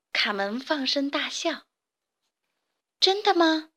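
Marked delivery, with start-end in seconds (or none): 3.0-3.7 higher, astonished question; surprised. A narrator's reading. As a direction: gasp it out in disbelief. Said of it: "吃惊地说". (吃惊地问)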